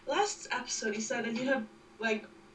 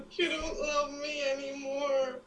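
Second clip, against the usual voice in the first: mock crying; 3 semitones higher than usual. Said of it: "don't cry".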